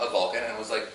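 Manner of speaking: ordinary talk, nothing marked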